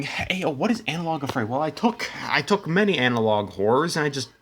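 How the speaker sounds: ordinary delivery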